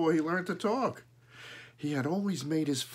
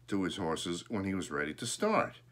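usual speaking voice

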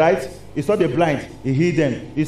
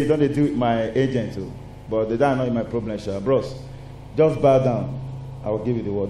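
lower, softer voice of a scheming prince spirit; low sly prince; 8 semitones lower, 5 dB quieter than usual. Acted. Prince spirit tempting the believer, low and coaxing. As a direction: purr the bargain low and quiet. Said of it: "I don't hear say".

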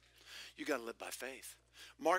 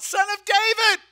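much higher, much louder desperate cry; 20 dB louder than usual. Acted. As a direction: cry out to Jesus as the blind beggar. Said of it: "and he cries out".

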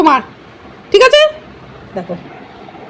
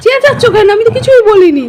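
high, raised-voice remonstrating; loud protest; 9 semitones higher, 7 dB louder than usual. Real, not acted. Owner asking the pet why it is yelling, loud protest. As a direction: protest your innocence over the noise.

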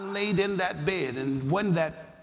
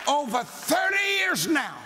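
preaching at full force; 8 semitones higher than usual. Acted. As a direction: drive it home to the congregation. Preaching climax to the congregation.

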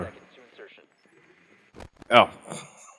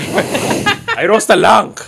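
fake Chinese accent; 10 semitones higher, 5 dB louder, much slower than usual. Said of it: "fake Chinese".

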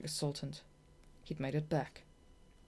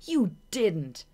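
high, louder stunned denial; 6 semitones higher, 9 dB louder than usual. Acted. Shade doubting Marina's win, loud high disbelief. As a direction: refuse to believe it.

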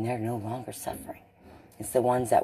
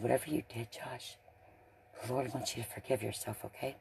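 soft, much quieter reassurance; gentle comfort; 10 dB quieter than usual. Retold or imitated. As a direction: ease him toward forgiving himself, softly. Retells comforting Josh gently.

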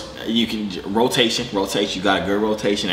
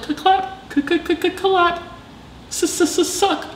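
high mock clapping sounds; much slower than usual. Acting out the wives clapping.

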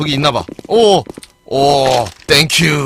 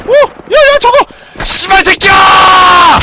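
much higher, louder, dragged out cursing yell; furious shout; 13 semitones higher, 8 dB louder, slower than usual. Real, not acted. Gamer cursing an enemy who ambushed him, furious shout. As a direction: roar at the enemy.

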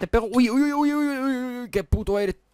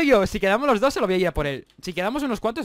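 ordinary delivery

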